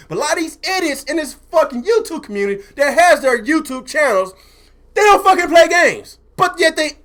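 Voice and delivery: high, exasperated rant peaking loud; real frustration. Real, not acted. Streamer fed up with YouTubers who don't play, frustrated. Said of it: "I'm getting frustrated".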